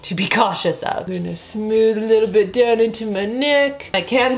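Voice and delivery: through a wet sheet mask; talking with face mask on. Real, not acted. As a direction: lips moving under mask. Seen 0: Thin wet sheet mask covers face and mouth area while she talks.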